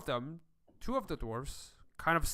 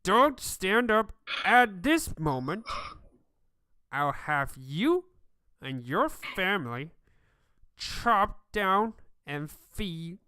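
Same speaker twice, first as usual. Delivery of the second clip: menacing threat; 4 semitones higher, 5 dB louder, slower than usual. Acted. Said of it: "talking to the ones that are crawling away".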